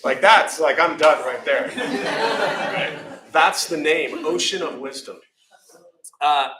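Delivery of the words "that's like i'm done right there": ordinary delivery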